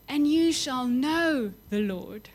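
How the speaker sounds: slow, measured repeat; slow echo. Acted. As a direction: let the words sink in slowly. Verse repeated slowly.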